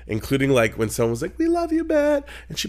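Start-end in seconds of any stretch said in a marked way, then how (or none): 1.4-2.3 mock fan call. Fan calling to drag queen.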